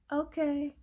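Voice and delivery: very high, small okay; retold reaction. Her reply to the grateful driver, high-pitched okay. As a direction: squeak out a tentative okay.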